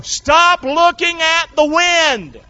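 high, loud, slow drawn-out command; shouted preaching command. Real, not acted. Preacher commands the congregation in the storm, shouted preaching. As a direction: hammer out each word at full force.

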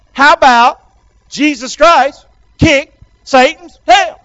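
loud, slow, word by word; call for repetition. Shown as a demonstration. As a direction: lead the crowd, punching each chunk. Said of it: "Can you say that with me?".

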